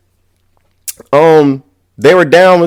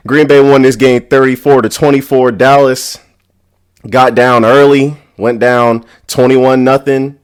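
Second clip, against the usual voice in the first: normal talking voice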